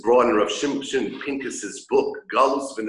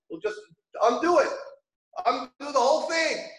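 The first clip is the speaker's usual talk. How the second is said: indignant tailor; 9 semitones higher than usual. Retold or imitated. Tailor offers to undo the suit.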